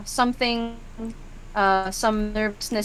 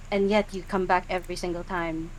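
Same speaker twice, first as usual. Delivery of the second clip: normal talking voice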